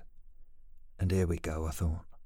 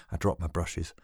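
ordinary delivery

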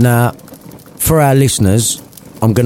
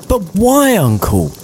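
pleading high voice; 11 semitones higher than usual. Acted. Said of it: "cried Scrooge's nephew".

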